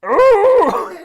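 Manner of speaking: very loud, high cartoon cry of pain; wolf's pained yelp. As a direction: yelp hard like a wolf being shot. Wolf shot again.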